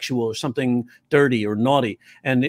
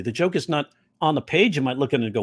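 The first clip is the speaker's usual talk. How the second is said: ordinary talk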